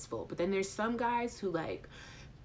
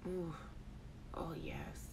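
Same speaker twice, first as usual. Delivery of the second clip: whispered, savoring it; very soft, lingering whisper; hushed moan; 3 semitones lower, 15 dB quieter, slower than usual. Acted. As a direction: breathe it out in a low whisper. Dreamily repeats the phrase from chat.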